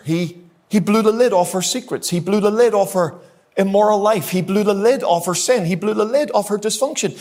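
rising pulpit fervour on each repeat; preaching build. Real, not acted. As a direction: drive the refrain home like a preacher.

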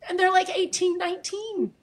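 high, teary voice; choked up, pitch lifted high; real tearfulness. Real, not acted. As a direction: keep talking while crying. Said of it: "I'm crying".

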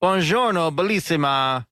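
slow, hammed-up Italian; mock Italian accent. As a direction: roll it out like a cartoon Italian. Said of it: "swept that category".

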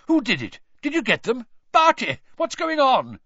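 high, loud urgent questions; alarmed questions. A narrator's reading. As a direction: fire off anxious questions. Bagman arriving breathless, alarmed.

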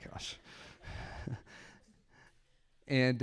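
normal talking voice

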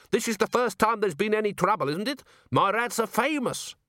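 high, indignant boasting; defensive dwarf cook. A narrator's reading. Cook defends his famous rats to customers, defensive. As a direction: protest proudly in a high character voice.

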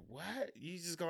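a small stunned question; quiet disbelieving wife. Retold or imitated. Wife asking why husband just walked away.

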